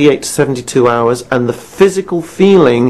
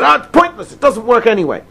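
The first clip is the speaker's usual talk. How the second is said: high, exasperated rant; mock ranting caller; 7 semitones higher than usual. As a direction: dismiss it all, fed up. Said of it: "he ranted on like that".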